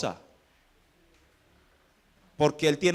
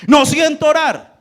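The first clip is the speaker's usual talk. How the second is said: protesta fuerte y aguda, imitando a quien se niega; excusa quejosa; 7 semitones higher, 9 dB louder than usual. Acted. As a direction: alza la voz como quien se excusa de orar. Voicing someone's excuse not to pray. (queja imitada en voz alta)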